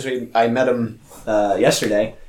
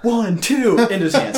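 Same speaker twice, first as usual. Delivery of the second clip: excited count; 9 semitones higher than usual. Retold or imitated. Acting out the ref's count.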